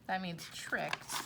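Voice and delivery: soft whispered aside. Real, not acted. Explaining a word to kids, whispered aside. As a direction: slip the meaning in quietly.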